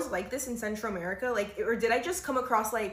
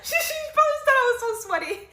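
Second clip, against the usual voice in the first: high, loud teary laughter; laughing hard; 8 dB louder than usual. Real, not acted. Laughing at the girl who called her sweaty. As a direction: crack up through the words.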